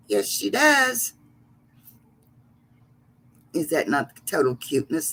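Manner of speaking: normal talking voice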